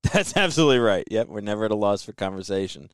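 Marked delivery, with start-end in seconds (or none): 0.0-1.0 laughing. Real, not acted. Host laughing at banter.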